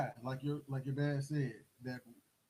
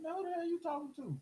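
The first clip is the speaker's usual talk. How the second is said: high, fast, mock-tough retort; defiant comeback; 13 semitones higher, faster than usual. Teen talking back to the threatening dad, defiant. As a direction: fire it back trying to sound bad.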